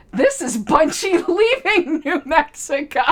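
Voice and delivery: high-pitched, talking through real laughter; laughing. Real, not acted. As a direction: barely get the joke out for laughing. Laughing while joking about clip.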